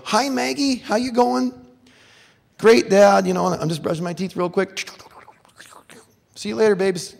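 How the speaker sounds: put-on high, loud, chirpy dad-to-toddler voice; sing-out cheerful dad hello; breezy dad greeting. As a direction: call out brightly to a little kid.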